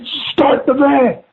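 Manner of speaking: high, drawn-out retold plea; stretched, high-pitched mock panic; frantic order. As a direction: imitate desperate urging, slow and high. Retold frantic order while fleeing.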